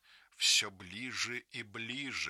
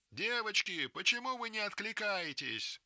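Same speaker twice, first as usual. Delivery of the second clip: anxious call; 11 semitones higher than usual. Acted. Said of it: "встревоженно спрашивает".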